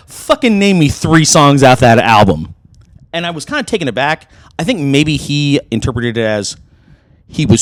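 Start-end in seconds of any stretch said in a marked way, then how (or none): 0.1-2.5 mock challenge. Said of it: "he goes".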